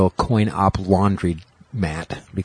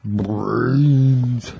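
zombie voice. Retold or imitated. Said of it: "he sounded like a zombie".

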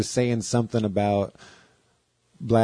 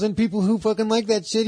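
higher, fast mock Andy voice; imitating Andy; 11 semitones higher than usual. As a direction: rattle it off quick and higher. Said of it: "He goes".

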